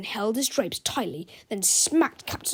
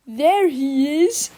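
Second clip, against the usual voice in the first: put-on slow, louder old ladies' shout; angry shout; 5 semitones higher, 8 dB louder, much slower than usual. A narrator's reading. Old ladies spotting Melvin, angry slow shout. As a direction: spit it out as outraged old ladies. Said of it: "shouted a couple of very angry old ladies".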